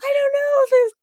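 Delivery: very high, fast mock panic; flustered excuse. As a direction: babble the excuse nervously. Tendi voiced, flustered excuse.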